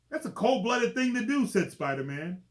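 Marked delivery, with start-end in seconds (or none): 0.1-1.5 high, loud mock outrage; loud hero wisecrack. Spider-Man dodging the claws, loud teasing quip. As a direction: throw the joke out big. Hero quips after lizard attack.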